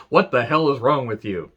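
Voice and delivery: hammed-up Rock rant at Stasiak; a hint of The Rock's mic cadence. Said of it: "He's like".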